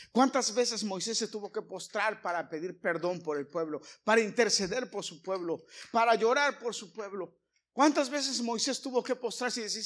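loud, rising sermon cry; preaching at full force. Acted. Preacher to congregation about Moses, loud and fervent. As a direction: preach it out, building up.

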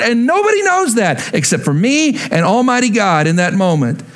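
a preacher building in pitch, fervent; rising preaching. Acted. Preaching climb in the sermon.

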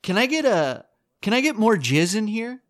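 high, prim request; comic acting out a bath-shop customer; mock polite customer. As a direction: play a fussy customer. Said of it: "It's like".

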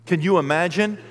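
ordinary talk

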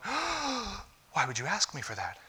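a pretend appalled God; hammed-up offended deity; mock shocked God. God imagined shocked at request.

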